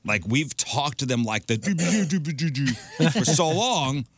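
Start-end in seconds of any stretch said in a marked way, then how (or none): 1.6-3.1 put-on baby-talk babble. Imitating baby talk sounds.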